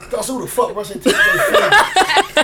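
high, loud laughing outrage; laughing disbelief. Real, not acted. Uncle laughing at the nephew's finger-brushing story.

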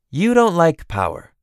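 high, knowing pronouncement; teasing cousin. Acted. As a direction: tell her knowingly what she feels. Theron teases Elaine.

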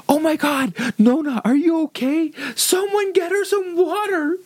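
very high, wailing hysterical impression; mock panic. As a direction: imitate a panicked brother in a squealing falsetto. Acts out brother panicking over grandma.

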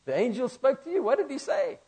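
put-on high, sing-song Yiddish accent. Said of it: "They probably said it like that".

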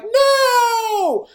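very high, loud, drawn-out wail; mock anguished cry. Onlookers watch him shoot himself, mock anguished cry. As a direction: wail it out in hammed-up horror.

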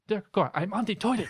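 exasperated spirit guide, pitched high; put-on accent, pestered and protesting; Sam. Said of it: "You should do a Sam impression".

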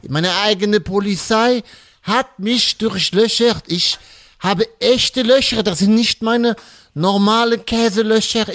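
ordinary talk, nothing marked

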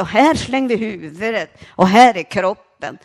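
loud raving shout; stepfather's shout. Retold or imitated. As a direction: bellow it in a delirium. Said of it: "Och så sa".

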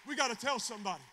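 loud, high, urgent preaching shout; rally the church to act at once; full-force exhortation. Real, not acted.

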